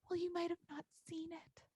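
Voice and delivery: put-on high, soft, sly kid's voice; a child slyly talking his way out; kid's excuse. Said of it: "the bully argues back".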